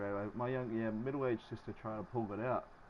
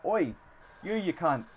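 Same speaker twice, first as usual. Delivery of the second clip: raised, friendly Aussie call-out; yelled greeting; 6 semitones higher, 5 dB louder, slower than usual. Acted. Australian showing a harmless yell to someone, raised call-out. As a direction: call it out louder, no threat in it. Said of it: "if someone yells out".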